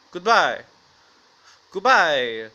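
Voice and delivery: loud goodbye with a swooping, drawn-out fall; mock intimidating. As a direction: boom it out as a hammed-up street threat. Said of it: "This is the most intimidating voice".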